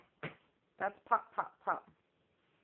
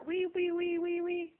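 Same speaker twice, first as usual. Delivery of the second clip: high, loud piggy wee; piggy squeal; 10 semitones higher, 4 dB louder than usual. Shown as a demonstration. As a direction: squeal like a little pig for a toddler. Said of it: "What does the piggy say?".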